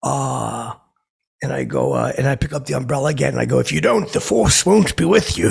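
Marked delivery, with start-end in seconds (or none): 3.6-5.5 Vader impression. Threatening Harrison Ford with an umbrella, loud mock Vader. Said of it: "I do it like Vader does it".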